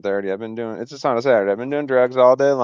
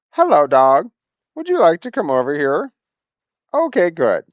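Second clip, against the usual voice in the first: louder, higher sing-song dog talk; calling the dog; 5 semitones higher, 7 dB louder than usual. Real, not acted. Calling the dog to come over, high and coaxing.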